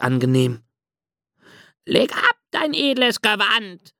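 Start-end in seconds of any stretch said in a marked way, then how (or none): 1.9-3.9 hohe, gehauchte Möwenstimme; rufende Möwe. A narrator's reading. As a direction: ruf wie eine kleine Möwe vom Strand. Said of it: "rief laut die Möwe Rose". (hohe Möwenstimme, rufend)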